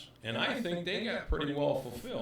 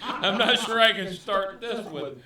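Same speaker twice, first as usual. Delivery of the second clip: laughing; 3 semitones higher, 7 dB louder than usual. Real, not acted. Fumbling to open the gift.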